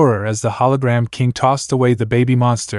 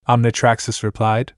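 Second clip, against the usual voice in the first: normal talking voice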